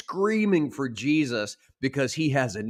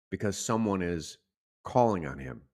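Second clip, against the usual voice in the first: ordinary talk, nothing marked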